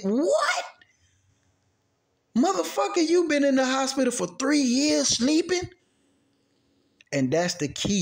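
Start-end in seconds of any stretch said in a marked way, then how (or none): 0.0-5.7 incredulous outburst. Acted. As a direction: blurt it out in high mock shock. Acts out shocked reaction to coma news.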